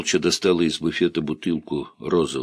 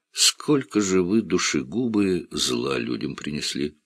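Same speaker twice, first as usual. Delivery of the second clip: usual speaking voice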